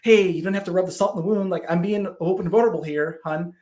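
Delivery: mock-wounded and lightly teasing; lighthearted protest. Husband joking back at his wife's jab, lighthearted protest. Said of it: "lighthearted moment, like".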